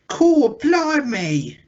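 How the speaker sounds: high, loud, drawn-out Cockney exclamation; mock Cockney. Aping all the kids in the cartoon, mock Cockney. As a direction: belt out a hammed-up Cockney cry. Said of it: "we're either Cockney or we're Oliver Twist".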